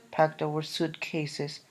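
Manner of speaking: ordinary delivery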